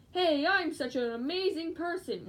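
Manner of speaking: mock-boast with a bounce; bouncy. Showing viewers their livestream self, bouncy mock boast. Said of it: "bounciness and".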